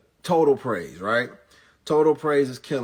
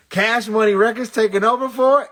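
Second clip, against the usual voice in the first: much higher, much louder hype yell; hype shout; 11 semitones higher, 11 dB louder than usual. Retold or imitated. Comedian shouts the rap call to the crowd, hype yell.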